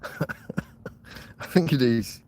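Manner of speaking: slow, quiet chuckle through words; amused quiet laugh after ranting. Real, not acted. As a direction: laugh at yourself. Laughing at his own rant.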